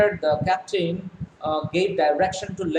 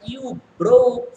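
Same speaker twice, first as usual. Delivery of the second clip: excited news; 6 semitones higher, 6 dB louder than usual. Acted. Daughter announces found island.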